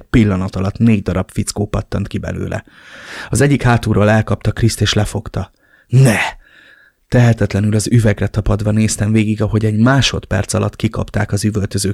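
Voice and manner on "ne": scream. Acted. Watching son kidnapped.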